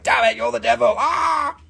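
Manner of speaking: shouted mock accusation ending in a high yelp; hammed-up shout at the witch-rock seller; mock outrage. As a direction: play a horrified believer calling you the devil. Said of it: "they're like".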